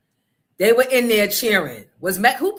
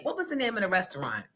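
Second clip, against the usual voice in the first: ordinary talk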